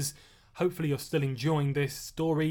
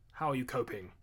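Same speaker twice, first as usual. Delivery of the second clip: softer, lower grave question; 3 semitones lower, 6 dB quieter than usual. A narrator's reading. Dutale asking Chris how he copes, grave. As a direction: drop into a grave, concerned tone. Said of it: "his tone turned more grave".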